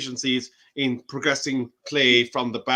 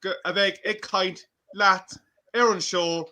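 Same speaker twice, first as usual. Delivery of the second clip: higher-pitched Irish accent, slipping into Irish; 5 semitones higher than usual. Shown as a demonstration. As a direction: show off authentic Irish speech on request. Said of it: "read out your Irish accent".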